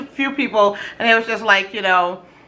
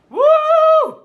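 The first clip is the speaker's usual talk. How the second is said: excited woo; 5 dB louder than usual. Real, not acted. Cheering about frozen watermelon.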